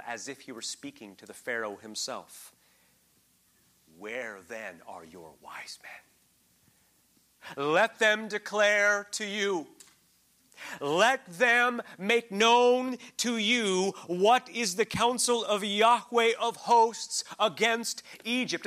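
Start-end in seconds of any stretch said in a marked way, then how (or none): 4.0-5.9 slow hushed taunting whisper; whispered challenge. Acted. Prophet taunting Pharaoh about his wise men, whispered. 7.6-18.7 slow, loud, high proclamation of the verse; preaching scripture. Acted. Preacher reading Isaiah's rebuke to his congregation, slow and loud.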